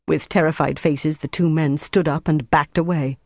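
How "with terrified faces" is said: ordinary delivery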